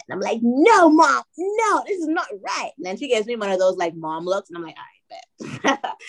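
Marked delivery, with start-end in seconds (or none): 0.4-2.7 high, insistent refusal to mom; retold protest. Protesting to her crying mother. 4.8-5.9 low, hushed grudging agreement; resigned giving in. Retold or imitated. Giving in after mom's look.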